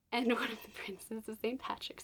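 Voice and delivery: laughing hushed reveal; whisper with laugh. Real, not acted. Vlogger teases the Irish prince to viewers, giggling in a whisper.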